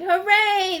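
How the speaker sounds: high, happy cheer. A narrator's reading. Friends celebrate no shadow with a joyful cheer. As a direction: cheer for an early spring. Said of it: "they shouted".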